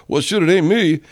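high, dismissive mock voice; mocking careless people. Mocking people who think they're safe, high sneer. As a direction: sneer it like a selfish jerk.

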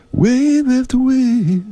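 high, slow, stretched-out announcer chant; wave after wave. Pretend. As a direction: sing it out slow and high like a dramatic promo. Same drawn-out line again.

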